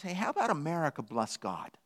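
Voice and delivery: very soft, gentle counter-suggestion; lightly voiced dog, quietly reverent; humble dog. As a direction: offer it in a hush. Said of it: "And a dog says".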